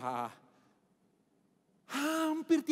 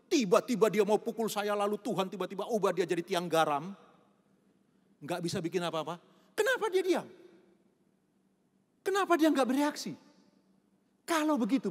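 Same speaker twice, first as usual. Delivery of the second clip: ordinary delivery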